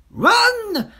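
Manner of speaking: high-pitched, loud monkey's shout; Mugglewump shouting. A narrator's reading. Monkey to the children in the playground, shouted warning. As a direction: yell an urgent warning. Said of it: "Mugglewump shouted to the children".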